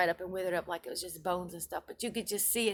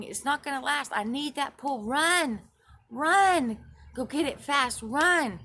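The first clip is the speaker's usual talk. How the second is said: high, loud urgent shouting; desperate high-pitched shouts of run; frantic yelling; 7 semitones higher, 8 dB louder than usual. Retold or imitated. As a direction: yell at him to hurry, the fish is dying. Urging a slow helper, panicking.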